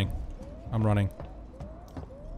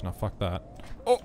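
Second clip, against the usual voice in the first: ordinary delivery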